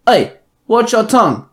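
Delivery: high, loud mock threat; Captain Cold warning Robin, sharp; threatening. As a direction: warn him off sharply. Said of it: "Captain Cold threatened".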